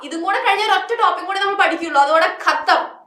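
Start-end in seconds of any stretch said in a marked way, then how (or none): none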